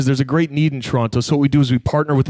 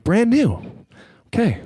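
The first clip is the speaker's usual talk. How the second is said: taken aback; 8 semitones higher than usual. Retold or imitated. Acts out doubtful reply to news.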